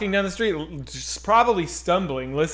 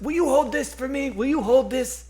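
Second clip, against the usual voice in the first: stranger's voice; 8 semitones higher than usual. Retold or imitated. Voicing the stranger's request.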